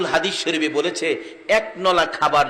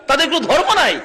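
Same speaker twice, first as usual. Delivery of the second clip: high, raised sermon voice; forceful preaching; 11 semitones higher, 6 dB louder than usual. Real, not acted. Preacher contrasting others' honesty with Muslims', high forceful preaching. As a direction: push the point out high and insistent.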